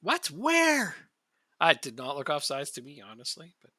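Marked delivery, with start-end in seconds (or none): 0.0-1.0 sudden high startled question; a real jolt of surprise; surprised. Real, not acted. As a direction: caught off guard, ask it sharply. Suddenly spots a goal.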